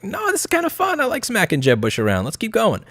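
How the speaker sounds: high, sneering mimic of Trump hooked on the race; mocking Trump, pitched high. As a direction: squeal it like he just found a new toy. Trump voiced, hooked on running.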